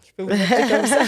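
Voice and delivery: laughing loudly while speaking; words spilling through a loud laugh. Real, not acted. Host laughs naming her nickname.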